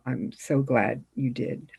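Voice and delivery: ordinary talk, nothing marked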